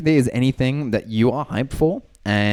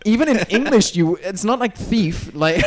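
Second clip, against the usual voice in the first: exasperated protest; 9 semitones higher than usual. Real, not acted. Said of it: "arguing over his name".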